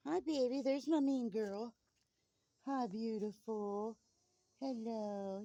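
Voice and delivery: high sweet pet talk. Real, not acted. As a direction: coo to the rooster. Baby talk to roosters.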